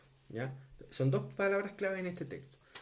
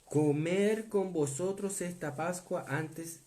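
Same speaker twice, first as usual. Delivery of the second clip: scripture reading; 3 semitones higher, much slower than usual. Acted. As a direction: draw out each word of the verse. Reads a Bible verse aloud.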